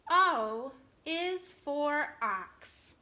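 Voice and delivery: very slow reading-along, word by word; slow choral reading. Shown as a demonstration. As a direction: read it together with the class. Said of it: "Read it with me".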